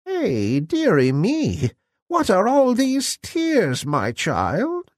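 high, slow, croaky crone voice; croaking old woman. A narrator's reading. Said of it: "said the old woman".

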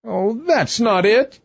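high-pitched tearful queen; acted sobbing protest. A narrator's reading. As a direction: wail it like an upset queen. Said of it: "sobbed Her Majesty".

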